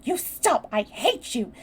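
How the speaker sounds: loud, furious tirade; heated, emphatic outburst; angry near shout. A narrator's reading. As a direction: lash out in a temper. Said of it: "she almost shouted".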